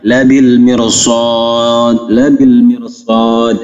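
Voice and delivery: slow, melodic tajwid recitation; Quran recitation. Shown as a demonstration. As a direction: chant the verse slowly like tilawah. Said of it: "Maka dibaca". (bacaan tartil yang lambat)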